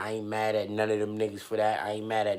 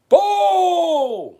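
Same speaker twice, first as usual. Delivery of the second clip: excited; 11 dB louder than usual. Real, not acted. Dramatic exclamation.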